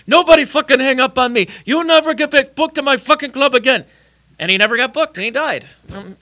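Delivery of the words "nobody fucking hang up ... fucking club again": put-on loud, high, furious Lebanese accent. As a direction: yell it like an angry club owner. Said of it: "So he calls up".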